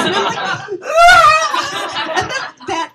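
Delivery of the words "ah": high, loud starstruck squeal; fangirl scream. Retold or imitated. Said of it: "I channeled all those girls screaming".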